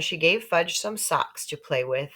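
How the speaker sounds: ordinary delivery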